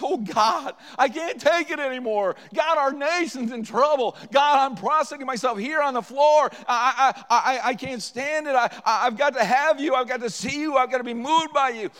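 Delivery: a man on the floor crying out for revival; desperate loud prayer. Retold or imitated. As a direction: beg God at full voice, breaking with need. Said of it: "One man says".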